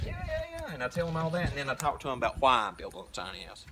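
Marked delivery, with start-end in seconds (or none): 0.1-0.7 much higher, breezy yeah yeah yeah; dismissive yeahs. Retold or imitated. Tells curious strangers he's building a tiny house, breezy. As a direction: brush it off lightly. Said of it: "I'm like".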